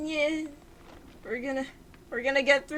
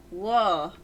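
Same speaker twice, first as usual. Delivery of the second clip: usual speaking voice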